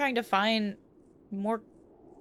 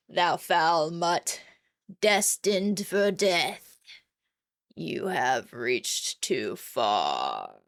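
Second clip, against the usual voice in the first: very slow, drawn-out ominous voice; menacing dark entity; 3 semitones lower, much slower than usual. Acted. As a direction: stretch every word like a looming doom god.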